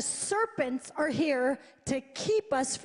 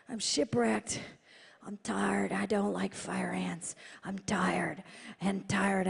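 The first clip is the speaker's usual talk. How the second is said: tired prayer; 6 semitones lower than usual. Retold or imitated. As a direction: drag it out, worn out. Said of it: "I said".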